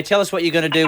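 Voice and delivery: usual speaking voice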